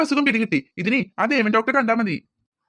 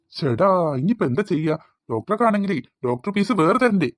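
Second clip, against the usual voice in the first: put-on old man; 5 semitones lower than usual. Old uncle begs the pharmacist for pressure pills, low and pleading.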